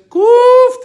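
very high, loud bark; shouted shut up. Shown as a demonstration. Recapping the word for shut up, very high shouted. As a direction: yell it like a command. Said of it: "tell someone to shut up".

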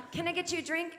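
lightly voiced infant offering drinks; newborn host. Newborn playing host to mom, lightly voiced. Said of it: "soiree going".

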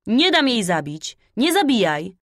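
high, loud cry of protest; wild little girl shouting in protest; piercing child's shout. A narrator's reading. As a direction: cry out to stop the killing. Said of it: "krzyczała przeraźliwie".

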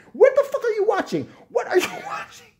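high, loud disbelieving shriek; high shocked screech; incredulous shriek. Real, not acted. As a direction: shriek at the absurdity.

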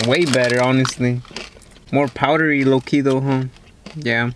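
words muffled by chewing; chewing while talking. Real, not acted. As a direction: mouth partly full of chips. Seen 0: takes chip to mouth at 0.8, jaw working, hand near mouth.

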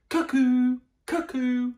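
high, loud cuckoo calls. Acted. The cuckoo clock chiming, high loud calls.

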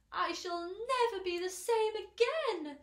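high, loud woeful complaint; self-pitying lament. A narrator's reading. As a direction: lament it dramatically, pitched high. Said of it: "murmured the earthworm".

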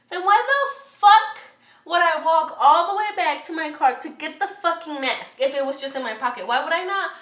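loud, high indignant rant; upset outburst. Retold or imitated. Customer raging over a pointless walk to the car, upset and loud. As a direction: explode on the first words and keep pushing. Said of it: "I was fucking upset".

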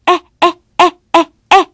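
high, clipped "Eh" pecks; hen pecking sound. Shown as a demonstration. Hen pecking at the deck with high, short pecks. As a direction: peck out each "Eh" short and high. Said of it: "This is how her pecking sounds".

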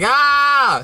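long, high, loud scream; mock scream. Joker acting kid walking in on parents, mock scream. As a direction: shriek it out in comic shock.

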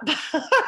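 short high burst of laughter. Real, not acted. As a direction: laugh it off brightly. Laughs at own remark.

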